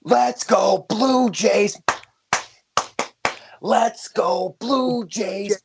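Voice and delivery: loud, high-pitched announcer hype; fired-up sports show opening; hyped show intro. Acted. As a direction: belt it out like a stadium announcer, drawing out key words.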